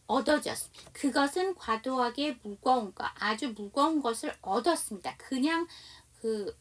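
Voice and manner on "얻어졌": ordinary talk, nothing marked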